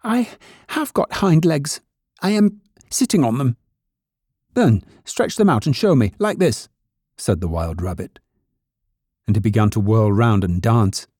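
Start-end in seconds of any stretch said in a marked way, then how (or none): 0.0-3.6 high-pitched, insistent rabbit voice; little rabbit's protest. A narrator's reading. Toy rabbit defending its hind legs, high protest. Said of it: "cried the little rabbit". 4.5-6.7 higher rabbit character voice; challenging wild rabbit. A narrator's reading. Wild rabbit demanding toy show legs, high challenging voice.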